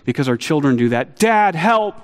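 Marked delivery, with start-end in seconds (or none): 1.2-2.0 put-on child calling for help.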